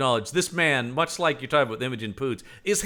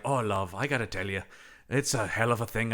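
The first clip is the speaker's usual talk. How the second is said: put-on Irish accent; 6 dB quieter than usual. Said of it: "he's doing his Irish accent".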